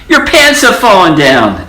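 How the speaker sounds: high loud shocked scream. A narrator's reading. Ladies screaming at the old mouse. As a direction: shriek it in horror. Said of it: "the ladies screamed".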